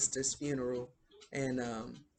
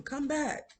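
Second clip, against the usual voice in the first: high-pitched mock call, little added volume; retold yell; 12 semitones higher than usual. Schoolmaster yelling at a boy in a boat, retold high call. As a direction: call out as if across water, lightly. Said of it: "He's yelling at him".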